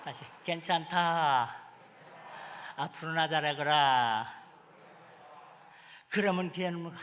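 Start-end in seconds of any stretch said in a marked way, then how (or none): 0.4-4.5 very slow, low, tender; 아주 느리고 낮게, 다정하게 달래듯; tender reassurance. Shown as a demonstration. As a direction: soothe your daughter with patience. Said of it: "대답도 아니야. 다시".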